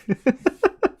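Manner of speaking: a gleeful squeal of a laugh; high excited laugh. Acted. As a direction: laugh high like a giddy kid.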